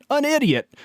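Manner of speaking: snide correction of his own word; emphatic correction. Real, not acted. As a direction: hit An idiot as a sharp correction. Correcting himself in an angry rant.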